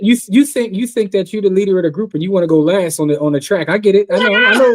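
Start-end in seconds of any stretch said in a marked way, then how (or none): 4.3-4.8 mock shout. Teasing friend, sudden outburst.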